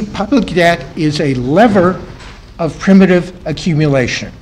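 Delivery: "lever" slowed and projected, ending kept strong; deliberate, fuller lecturing voice. Real, not acted. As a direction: speak up for the back of the room. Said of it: "Try to grow your voice".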